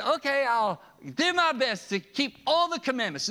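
high, earnest model answer; what he should have said. Retold or imitated. Preacher voicing the ideal rich man's answer, earnest and high. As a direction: voice the ideal eager reply, lifted. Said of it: "He should have said".

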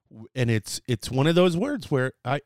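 ordinary delivery

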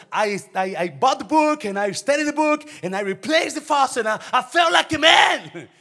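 high, loud, sing-song boast; triumphant shout. Retold or imitated. Preacher boasting to the congregation, shouting and sing-song. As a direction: crow it out like a proud new man. Boasting about fixing the faucet.

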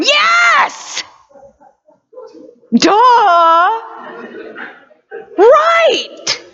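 hammed-up exasperated God yelling; sarcastic God. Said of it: "I can see God going".

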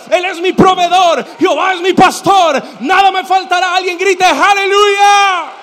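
high, very loud declarations climbing to a stretched cry; full-force preaching shout. Real, not acted. Preacher rallying congregation to shout hallelujah, fervent full-force shout.

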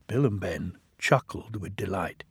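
ordinary delivery, nothing marked

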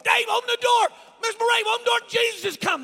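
high, full-force yell at a door; acted frantic plea to be let in; panicked screaming. Boy beating on the neighbours' door, panicked screaming. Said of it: "What are you screaming?".

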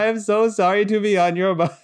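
high-pitched, pleading mock wail; imitated crying wail. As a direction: wail it like a panicked kid begging. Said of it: "I started crying and wailing".

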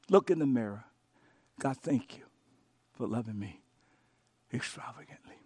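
a hushed devotional murmur; whispered prayer. Real, not acted. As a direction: pray it under your breath. Pastor models quiet prayer.

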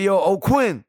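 calling out. Retold or imitated. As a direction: call his name to get his attention. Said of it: "I was like".

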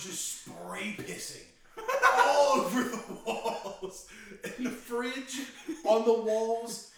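can barely get it out for laughing; laughing while telling. Real, not acted. Telling friends the sleepwalking story, cracking up loudly.

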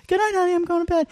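high, airy fake-casual goodnight; excusing yourself too brightly to get away; high put-on goodnight to the wife, light and innocent; mock sweet goodnight. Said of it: "I was like".

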